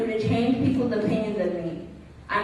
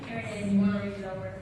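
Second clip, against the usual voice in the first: quiet student; 2 semitones lower, 9 dB quieter, faster than usual. Acted. Student offering a draft to the teacher, quiet. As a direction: hand it over very softly.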